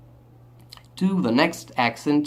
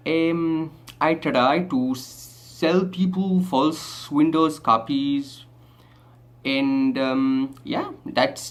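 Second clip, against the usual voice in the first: put-on Indian English accent; 2 semitones lower than usual. Shown as a demonstration. Rakesh introducing his life and fraud job, Indian accent. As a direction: play a chatty tech-support man with an Indian accent. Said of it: "an Indian accent".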